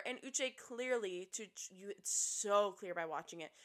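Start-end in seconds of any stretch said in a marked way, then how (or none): none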